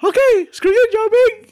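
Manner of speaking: very high, huffy cartoon falsetto; Nikki Moss. Cartoon correspondent turning on Jiaming, huffy high falsetto. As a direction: squeal it like an offended cartoon mouse. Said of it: "Okay, Nikki".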